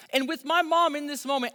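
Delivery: usual speaking voice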